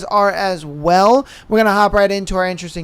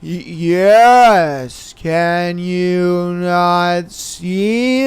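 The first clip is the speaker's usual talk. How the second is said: dazed slow reply; 3 semitones lower, much slower than usual. Retold or imitated. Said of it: "And I'm like".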